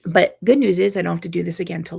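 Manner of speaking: ordinary delivery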